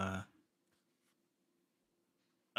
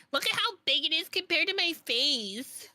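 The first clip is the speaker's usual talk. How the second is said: squeaky high voice. Real, not acted. Showing off huge cup.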